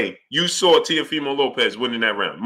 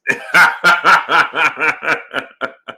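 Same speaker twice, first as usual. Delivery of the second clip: laugh; 2 semitones lower, 3 dB louder than usual. Real, not acted. Laughs at his own joke.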